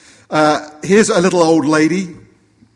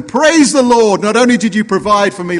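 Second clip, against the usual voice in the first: shouting towards heaven; 7 semitones higher, 6 dB louder than usual. Acted. Old lady thanking God for groceries, jubilant shout. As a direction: proclaim it upward with delight. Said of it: "shouts towards heaven".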